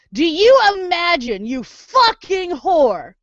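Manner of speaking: real furious rant. Real, not acted. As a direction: shout it at the screen, furious. Ranting at hated character.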